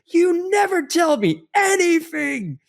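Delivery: very high, drawn-out mock shriek; retold shout. Retelling his wife's outburst to the podcast host, mock shouting. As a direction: imitate an outraged shout, stretched out high. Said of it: "we literally shouted".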